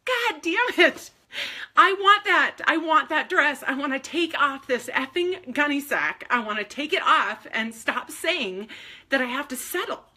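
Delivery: loud, high, fed-up cry of wanting; frustrated outcry. Retold or imitated. Telling God she wants the pink dress, loud frustrated outburst. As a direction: shout it out, done with settling. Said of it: "And I was like".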